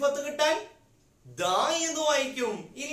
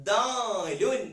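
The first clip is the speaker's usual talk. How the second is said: drawn-out letter name; 3 dB louder, much slower than usual. Shown as a demonstration. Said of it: "ഈ അക്ഷരത്തിന്റെ പേര്".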